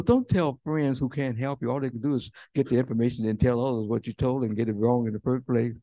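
ordinary talk

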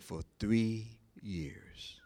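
slow, breathy whisper of a hurt wife; whispered confession. Retold or imitated. Wife whispering her answer to the pastor, slow and hushed. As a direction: breathe it out slowly, ashamed.

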